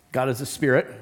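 ordinary delivery

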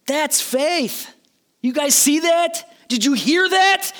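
acted astonishment, calling on everyone to notice; marveling. Jesus turning to his followers, loudly marveling at such faith. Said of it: "He's marveling".

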